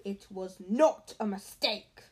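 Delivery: slow loud angry retort. A narrator's reading. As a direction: snap back angrily. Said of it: "said Harry angrily".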